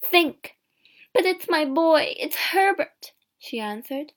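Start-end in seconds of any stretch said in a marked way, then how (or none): 0.0-0.6 high, loud command; loud, pleading exclamation; urgent cry. A narrator's reading. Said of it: "he cried". 1.1-3.1 high, loud insistence; urgent, emotional outcry; impassioned protest. A narrator's reading. Wife protesting to frightened husband.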